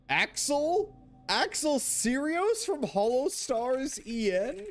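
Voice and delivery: high, loud, drawn-out disbelief; hammed-up high-pitched astonishment; mock amazed squeal. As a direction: squeal the name like a starstruck fan. Mock amazement at a known name.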